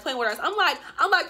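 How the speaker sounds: ordinary talk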